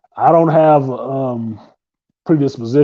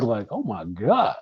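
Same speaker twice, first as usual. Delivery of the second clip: laughing; 7 semitones higher than usual. Retold or imitated. Laughing about his crush.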